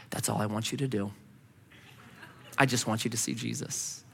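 low, very soft and sincere; gentle plea. Retold or imitated. Moses to the gathered office staff, gently earnest. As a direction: softly imitate a gentle evangelist.

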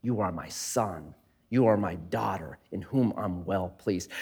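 put-on the Heavenly Father quietly blessing his child; tender fatherly voice. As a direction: speak it gently as a loving father. God speaking over his child.

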